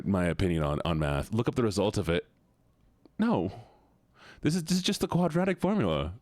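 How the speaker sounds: ordinary delivery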